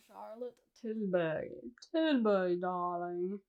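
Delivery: hammed-up luxury-brand darling, drawn out; mock fancy. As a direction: lightly play the fancy customer, stretching the name. Hams up a luxury brand name.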